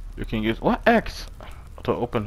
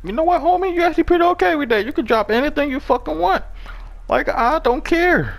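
sarcastic high voice; 13 semitones higher than usual. Pretend.

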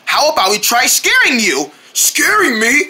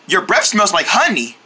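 ordinary delivery

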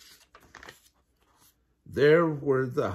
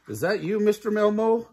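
higher, louder calling question; calling out to a mailbox she can't see; hopeful question; 8 semitones higher, 4 dB louder than usual. Acted. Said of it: "she asked the mailbox".

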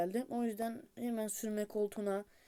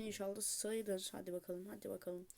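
1 hushed hope; 12 dB quieter than usual. Real, not acted. Hoping the run counts.

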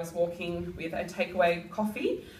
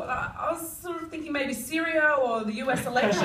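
ordinary talk

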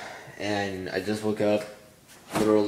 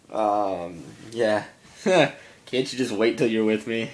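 normal talking voice

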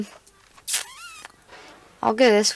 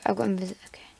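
whisper; 2 semitones lower, 8 dB quieter, faster than usual. Real, not acted. Goes invisible in game.

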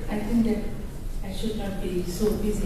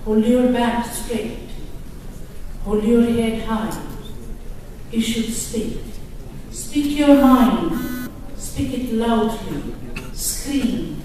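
rising, declaiming poem reading; poem recital; 5 dB louder than usual. Acted. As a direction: read it like a defiant anthem to an audience. Reads her translated poem aloud.

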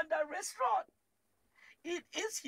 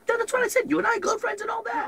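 louder, higher protest; indignant loud; 7 semitones higher, 11 dB louder than usual. Real, not acted. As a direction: insist loudly it was right. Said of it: "you say girlfriend".

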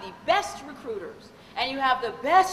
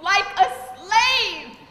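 tearful client; 5 semitones higher, 5 dB louder, slower than usual. Retold or imitated. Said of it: "she said".

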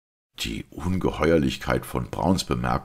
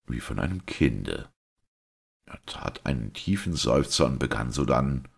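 ordinary delivery

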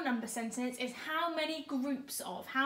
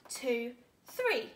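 ordinary talk

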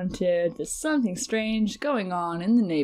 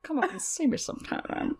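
Mae West; 8 semitones higher, 4 dB quieter than usual. Retold or imitated. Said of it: "I channeled Mae West".

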